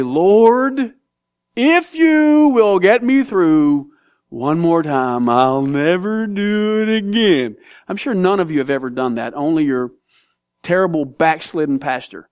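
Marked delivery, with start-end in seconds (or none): 0.0-7.6 slow, drawn-out pleading with God; a hint of a sick drinker bargaining, slowed right down; mock drunk's plea. Someone who drank too much begging the Lord, slow mock plea. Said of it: "and you say".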